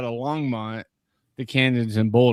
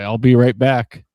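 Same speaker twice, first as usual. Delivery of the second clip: Tom Hanks quote; 9 dB louder, slower than usual. Retold or imitated. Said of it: "the whole Tom Hanks line from, uh, Castaway".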